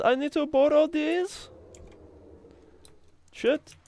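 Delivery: squeaky high-pitched begging voice, slowed on some words; high cartoon voice pleading for eggs; silly high voice. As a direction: play a tiny polite egg-beggar in a high squeak.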